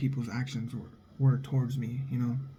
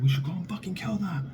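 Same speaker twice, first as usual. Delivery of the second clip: higher imitated gangster hothead; Godfather hothead; 5 semitones higher, 3 dB quieter than usual. Son urges killing enemies, higher imitated hothead. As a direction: play the hotheaded son from the film. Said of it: "the dude's like".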